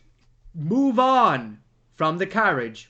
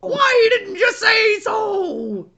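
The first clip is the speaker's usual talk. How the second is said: much higher and louder, slow, half-shouted; mock doorman shout; 6 dB louder, much slower than usual. Doorman delighted by the ruby slippers, high slow shout. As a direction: screech it out in delight.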